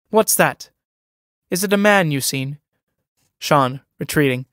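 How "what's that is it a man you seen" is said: higher, sudden sharp question. Acted. Pegeen turns on Sean sharply, higher and urgent. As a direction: snap round on him, pitched up. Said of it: "Pegeen, turning on him sharply".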